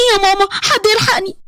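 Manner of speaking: high, tearful fright; panicked cry for help. Acted. Bride grabbed in a dark house, panicked.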